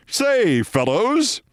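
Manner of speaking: high hearty clubman greeting; the genial host in a three-piece suit; mimicking Scott. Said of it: "So Scott comes over and says".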